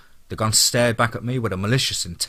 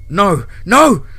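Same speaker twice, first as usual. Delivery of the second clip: two loud cries, the second higher; yelling into phone; 9 semitones higher, 9 dB louder than usual. A narrator's reading. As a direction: escalate to a desperate scream. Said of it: "Brady yelled into his phone".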